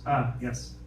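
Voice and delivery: ordinary delivery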